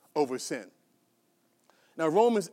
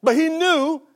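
rising preaching; 3 semitones higher, 5 dB louder than usual. Acted. Preaching climax.

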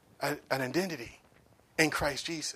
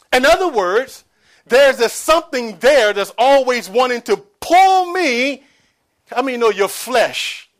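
louder, driving sermon; preaching; 4 semitones higher, 11 dB louder than usual. Acted. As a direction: press the point home hard. Sermon climbing in force.

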